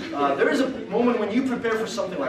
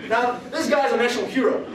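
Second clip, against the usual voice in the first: normal talking voice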